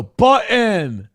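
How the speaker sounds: slow "button" with no t; mocking "button" without the t; glottal t. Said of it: "you sound like a complete troglodyte".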